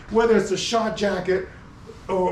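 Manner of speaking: ordinary talk, nothing marked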